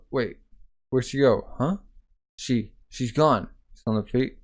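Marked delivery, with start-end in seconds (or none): none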